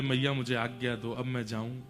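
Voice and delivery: low, soft request to go; humble leave-taking. Acted. Akrur asks Yashoda's leave to go, low and humble.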